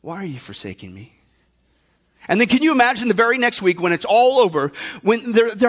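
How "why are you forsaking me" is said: put-on fading, hushed child's plea; a small voice fading out. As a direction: let the child's question die away to almost nothing. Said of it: "your son is there going".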